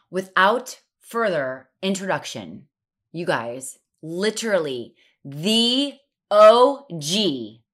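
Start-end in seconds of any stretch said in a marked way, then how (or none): 4.0-7.6 higher, louder, slow drawn-out build-up; host hyping her guest to listeners; hype intro. Real, not acted. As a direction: land the guest reveal big.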